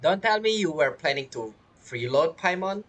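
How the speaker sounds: ordinary delivery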